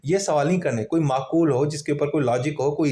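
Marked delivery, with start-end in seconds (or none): none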